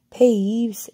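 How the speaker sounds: loud, high, furious shout of a name; angry yell. A narrator's reading. Professor yelling up at the poltergeist, angry shout. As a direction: bellow it across the hall in anger. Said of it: "yelled an angry voice".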